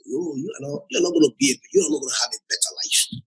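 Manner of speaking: ordinary delivery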